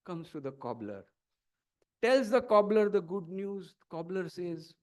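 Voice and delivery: normal talking voice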